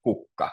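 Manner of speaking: kukka with the stress on KUK; first-syllable stress. Shown as a demonstration. Teacher shows learners a Finnish word, stress on first syllable. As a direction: hit the first syllable of kukka, let the second drop. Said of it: "it's always on the first syllable".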